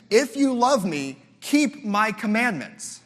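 preacher's climax; emphatic quote. Acted. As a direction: proclaim it slowly. Quoting Jesus emphatically.

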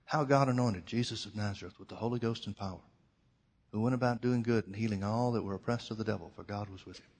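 soft recitation, trailing to almost nothing; hushed voice of the Holy Ghost; small quiet voice. Retold or imitated. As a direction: speak it gently, as if from down a well. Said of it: "He said this voice".

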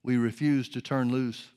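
earnest praying aloud to God; fervent prayer. Acted. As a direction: pray it with conviction. Prayer continues.

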